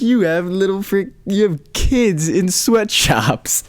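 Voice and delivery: high, slow mock jab; imitating Ricky Gervais. Comedian roasting the Apple guys at an awards show, high and slow. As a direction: needle them like a roasting host. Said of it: "He's like".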